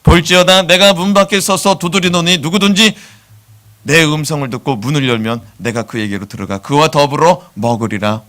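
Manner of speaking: solemn scripture recitation, rising loud at the start; measured Bible recitation; recited scripture. Shown as a demonstration. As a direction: declaim the verse like a preacher. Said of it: "암송해서 읽어줬습니다".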